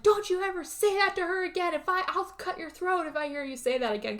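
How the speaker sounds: high, hammed-up angry threat; mock furious threat. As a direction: threaten him like he insulted her. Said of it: "Tyler freaks out".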